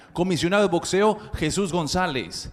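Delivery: ordinary talk, nothing marked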